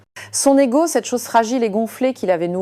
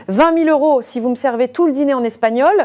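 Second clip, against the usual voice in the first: higher, louder, commanding the waitress; imperious; 6 semitones higher, 4 dB louder than usual. A narrator's reading. Rich diner ordering the waitress, imperious. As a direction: lay down the bet like an order. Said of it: "ton impérieux".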